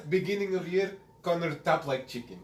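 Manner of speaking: imitating Khabib's Russian accent; laughing Russian accent impression. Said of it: "I like your Russian accent".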